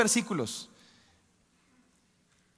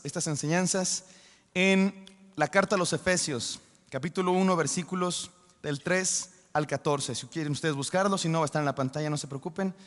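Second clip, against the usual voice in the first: ordinary delivery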